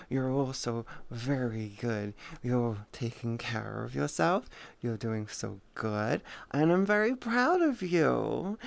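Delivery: soft, low cooing to a good dog; doting owner cooing to Sky; pet talk. Acted. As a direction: praise the puppy gently, a little sing-song.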